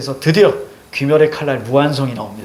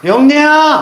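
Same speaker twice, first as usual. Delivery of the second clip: high, loud call of the name; cheeky shout; 10 semitones higher, 5 dB louder, much slower than usual. Shown as a demonstration. Teacher shows students how to call the name out, loud and high. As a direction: yell it across the hall like a prank. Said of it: "가서 이렇게 불러".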